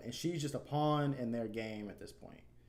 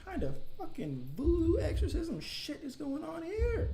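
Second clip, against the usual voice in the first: very high, slow, strained squeal; shrill mock-horror falsetto; freaked-out squeal; 5 dB louder, slower than usual. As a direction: squeak it out in horrified disbelief. Freaked out by Yuri's creepy scene.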